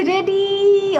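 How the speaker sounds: high, long-held singsong call; excited cheer. Retold or imitated. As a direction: stretch the last vowel in a high cheer. Reads out viewers' eager replies. (উচ্ছ্বসিত টানা সুরে)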